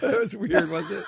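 high, giggling words through real laughter; can't stop laughing; laughing fit. Real, not acted. Host laughing helplessly at his own weird voice.

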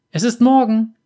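put-on high, loud, happy boy's shout; excited child. A narrator's reading. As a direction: announce it like an eager little boy. Boy excitedly announces morning.

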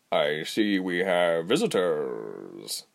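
slow, drawn-out purr; low, leisurely tabby-cat creature voice. A narrator's reading. Fat tabby greeting captives in his hut, purring. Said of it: "he purred".

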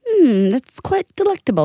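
a light hint of a posh British accent; mock British. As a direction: savor the sandwich in a playful British accent. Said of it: "She turned British".

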